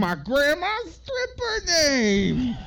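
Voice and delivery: very high, loud, drawn-out impression; Jennifer Coolidge. Podcaster jumping in as the actress, high loud caricature. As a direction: blurt it as an over-the-top wailing caricature. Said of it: "And Jennifer Coolidge says that".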